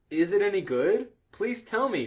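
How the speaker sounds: ordinary talk, nothing marked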